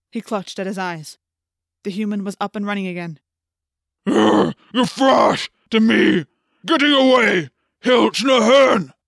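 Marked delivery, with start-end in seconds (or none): 4.1-8.9 loud, drawn-out monster bellow; yelling alien. A narrator's reading. Hork-Bajir calling for help as human gets away, yelling. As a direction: roar for help in a strange alien voice. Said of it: "the Hork-Bajir yelled".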